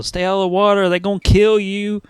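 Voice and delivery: loud, high moviegoer yelling at the screen; mock yell. Moviegoer warning characters on screen, mock yell. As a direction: yell it out like an annoying cinema heckler. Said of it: "go to the fucking movies and yell".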